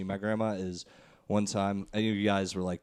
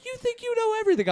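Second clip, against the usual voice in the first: much higher, fast annoyed grandma; 4 dB louder, faster than usual. Retold or imitated. Grandma annoyed at her arguing grandson, high and fast. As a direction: snap it like a fed-up grandma. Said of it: "she got annoyed".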